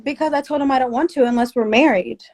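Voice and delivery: ordinary delivery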